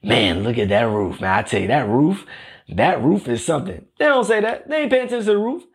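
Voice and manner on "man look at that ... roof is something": admiring house guest. Acted. Imagined visitor praising a host's roof, mock-admiring in a low voice. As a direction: play an impressed visitor, pitched well down. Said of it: "No one ever walks up and says".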